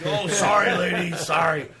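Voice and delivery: put-on loud drunk apology. Drunk guitarist loudly apologizing to the woman he fell on. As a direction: blurt it out like a drunk who just fell on someone.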